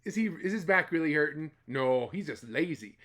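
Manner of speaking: plain reading voice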